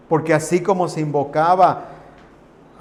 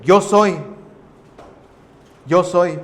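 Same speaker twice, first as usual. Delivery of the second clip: ringing proclamation; 6 dB louder, slower than usual. Acted. Preacher proclaims "Yo soy" loudly to the congregation. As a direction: cry out the name as a declaration.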